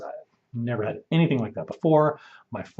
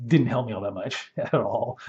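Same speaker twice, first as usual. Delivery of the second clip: normal talking voice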